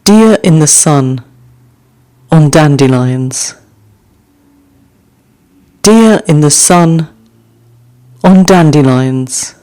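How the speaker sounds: gentle recital of a short poem; poem reading. Acted. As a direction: let each image settle before the next. Reads haiku aloud twice.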